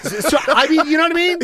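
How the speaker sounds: high laughing words; breaking into giggles while asking. Real, not acted. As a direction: laugh through the question.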